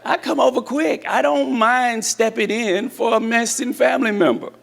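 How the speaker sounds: lightly voiced eager helper, pitched high; jokey high-pitched volunteer, with a laugh; Brother Cody. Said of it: "then you call Brother Cody and he helps you out".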